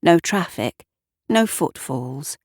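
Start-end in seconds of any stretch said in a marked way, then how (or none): none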